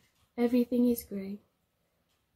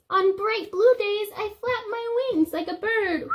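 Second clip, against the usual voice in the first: excited; 9 semitones higher, 5 dB louder than usual. Acted. Reading the bird page brightly.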